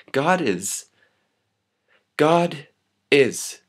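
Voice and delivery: emphatic lines broken by long pauses; slam poem. Acted. Poet pleading with audience against hate, insistent slam. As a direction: build in insistent repeats, then ease down. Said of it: "I'm gonna slam it for you".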